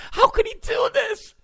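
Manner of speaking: very high, shouted wail; mock outraged shriek. Host mocking reporters, shrieking very high. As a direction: wail it like a betrayed fan.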